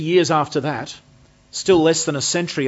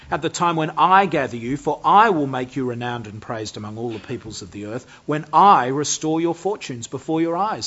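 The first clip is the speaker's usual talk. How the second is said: "I" hit hard at each promise; stress on I; 4 dB louder than usual. Acted. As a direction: lean loudly on every "I" as God's declaration. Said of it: "is the emphasis on God's action".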